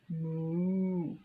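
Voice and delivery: ordinary delivery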